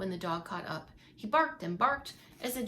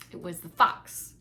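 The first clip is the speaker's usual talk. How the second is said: ominous reveal; 4 semitones lower, 5 dB louder, slower than usual. A narrator's reading.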